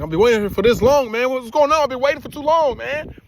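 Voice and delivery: 1 impatient complaint. Retold or imitated. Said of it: "It was like".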